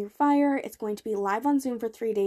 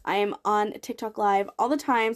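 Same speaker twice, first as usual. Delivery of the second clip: ordinary talk, nothing marked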